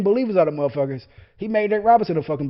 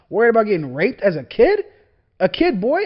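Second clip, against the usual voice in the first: louder, higher incredulous repeat; 6 semitones higher, 8 dB louder than usual. Real, not acted. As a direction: throw the phrase back in disbelief. Repeats the phrase in disbelief.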